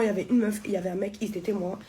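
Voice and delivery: ordinary delivery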